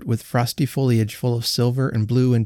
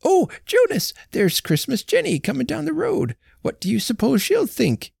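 put-on much higher, flustered old wife; fretting old woman; 13 semitones higher than usual. A narrator's reading. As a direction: worry what the neighbour will think. Old wife frets about neighbour.

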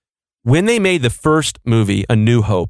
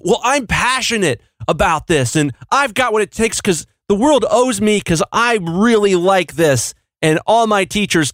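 mock entitled dreamer; 8 semitones higher than usual. Mocking the entitled creator's lie.

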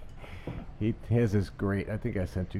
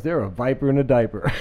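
louder, laughing through the punchline; 4 semitones higher, 7 dB louder than usual. Real, not acted. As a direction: giggle out the joke line. Quoting a pastor's joke.

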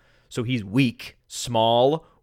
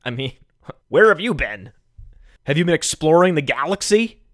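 ordinary talk

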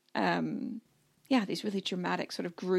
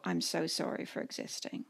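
breathy whispered apology, voiceless; a small scolded-child whisper; mock cowed whisper; 3 semitones lower, 8 dB quieter than usual. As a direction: shrink and whisper like a scolded kid. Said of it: "you go".

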